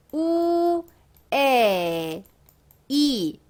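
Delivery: 우, 에, 이 each drawn out separately; long, separate 우, 에, 이 with the e clearly present; vowel breakdown. Shown as a demonstration. Teacher correcting the reading, vowel breakdown. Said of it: "어떻게 읽는다?".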